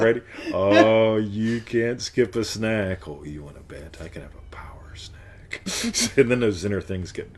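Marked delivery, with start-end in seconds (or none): 0.5-3.1 slow, drawn-out loud opening; mock inner voice. Shown as a demonstration. Demonstrates playful inner critic voice. 3.1-5.6 low, hushed reply; sly comeback. Shown as a demonstration. Inner voice answers back, seductively soft.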